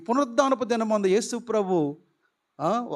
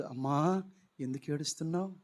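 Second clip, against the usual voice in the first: much softer, lower voice asking gently; tender question; 6 semitones lower, 11 dB quieter than usual. Acted. Jesus gently asking weeping Mary why she cries, soft. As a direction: comfort a weeping woman softly.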